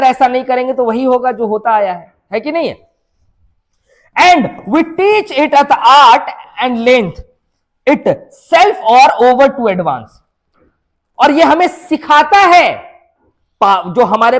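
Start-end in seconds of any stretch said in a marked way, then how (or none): 4.2-10.1 very slow, drawn-out verse reading; poem recitation. Acted. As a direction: read each word out slowly and deliberately. Reads poem lines aloud.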